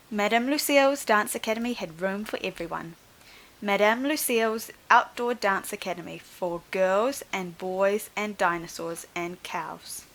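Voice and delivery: ordinary delivery, nothing marked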